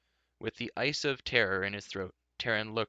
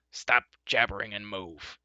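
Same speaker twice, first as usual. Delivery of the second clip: louder angry sputter; furious sputter; 3 dB louder than usual. A narrator's reading. Furious dwarf sputtering at the chattering companions. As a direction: sputter with fury. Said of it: "he sputtered".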